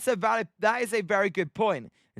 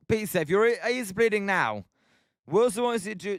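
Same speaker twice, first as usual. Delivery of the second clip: usual speaking voice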